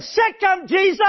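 very high parrot squawk for the punchline. Retold or imitated. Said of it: "the parrot said".

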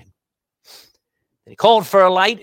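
slow, loud, drawn-out reading of the verse; scripture reading. Acted. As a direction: read the verse out with weight. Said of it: "Look at verse twenty-nine".

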